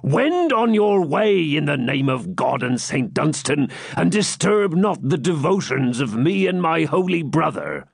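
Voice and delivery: high, drawn-out pious bluster; blustering hermit. Acted. Hermit to a caller outside his door, blustering piously. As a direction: send them off in a high, sanctimonious call.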